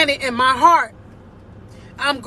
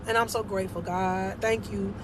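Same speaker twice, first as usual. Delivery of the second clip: much softer, low prayerful thanks; quiet thanks; 6 semitones lower, 10 dB quieter than usual. Real, not acted. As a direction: murmur gratitude to God. Personal thanks to God.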